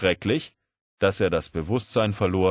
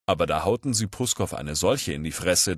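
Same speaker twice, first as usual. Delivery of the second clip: ordinary delivery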